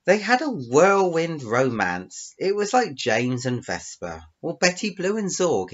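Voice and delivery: loud, high, grandly drawn-out storytelling; story narration. Acted. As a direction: declaim the tale with relish. Reads out own story.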